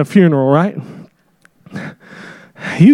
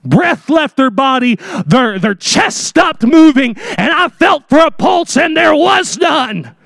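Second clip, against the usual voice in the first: higher, shouted insistence; insistent witness; 6 semitones higher than usual. Acted. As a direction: proclaim it like a shaken witness.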